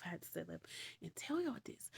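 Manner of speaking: whispered aside. Real, not acted. Narrator confiding to her viewers, whispered aside. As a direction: lean in and whisper it.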